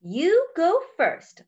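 high, slow, stretched-out words; slow turtle. Acted. Turtle lets Duck and Hippo ahead, slow turtle voice. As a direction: draw it out like an unhurried turtle.

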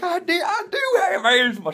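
high, squeaky laughing insistence; laughing protest. Retold or imitated. Grandson insisting to grandma he has asthma, laughing high. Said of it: "I'm like".

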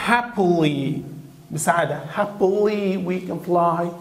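happily said slowly with a light sing-song; slow happily demo. Shown as a demonstration. Teacher gives a cheerful example sentence, slowly. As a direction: drawl happily we can fly. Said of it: "لما نقوله هاب".

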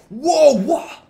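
very high, loud outburst; real amazement, voice leaping way up; astonished. Real, not acted. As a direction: react in squealing disbelief. Amazed by the new trick.

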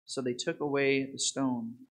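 ordinary talk, nothing marked

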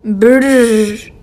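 shivering brrr from the cold; cold lips trembling brrr. Pretend. Imagining freezing Himalayan peaks.

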